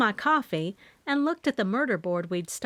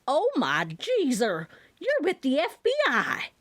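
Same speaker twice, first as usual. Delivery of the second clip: excited; 6 semitones higher than usual. A narrator's reading. Mama's sudden excited discovery.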